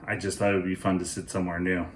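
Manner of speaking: usual speaking voice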